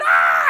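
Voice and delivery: loud acted scream. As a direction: let out a long scream of panic. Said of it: "I'm just screaming".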